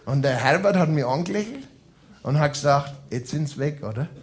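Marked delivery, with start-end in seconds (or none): 3.1-4.1 soft timid question. Retold or imitated.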